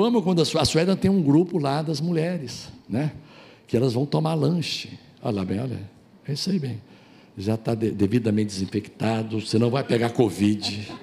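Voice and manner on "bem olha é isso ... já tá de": ordinary talk, nothing marked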